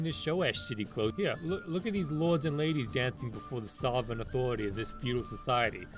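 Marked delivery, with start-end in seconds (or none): none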